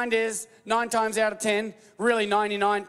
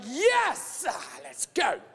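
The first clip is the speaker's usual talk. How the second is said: thrilled cheer; much slower than usual. Retold or imitated. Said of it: "I'm gonna be like".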